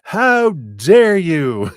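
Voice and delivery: a theatrical offended gasp of a line; mock indignation. Instead of curiosity, a hammed-up indignant slow outburst. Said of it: "instead of going".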